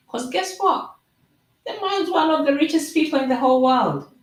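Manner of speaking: high, fast excited reveal. Real, not acted. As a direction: announce it like big news. Excited reveal of his wealth.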